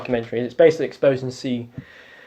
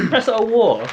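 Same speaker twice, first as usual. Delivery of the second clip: a high, loud jokey Russian accent put-on; clowning a Russian accent for a laugh; mock Russian accent; 9 semitones higher, 7 dB louder than usual. Cohost jokes in a loud mock Russian accent. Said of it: "Sorry, I've been a bit Russian then".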